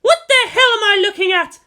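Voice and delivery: high, loud startled shout. A narrator's reading. Misato shouting at the mess outside orbit, loud startled yell. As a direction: yell it at the screen in disbelief. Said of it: "Misato shouted".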